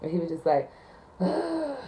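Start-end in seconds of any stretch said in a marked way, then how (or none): 1.2-1.8 a higher, put-upon sigh; imitated sigh. Caller sighing before asking for a man, mocking sigh. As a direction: sigh like the whole call bores you. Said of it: "you hear this sigh".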